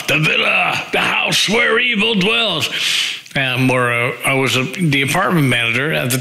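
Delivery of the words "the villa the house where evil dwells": spooky announcer. Pretend. Naming the band house, hammed-up horror-trailer voice. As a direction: boom it out like a haunted house ad.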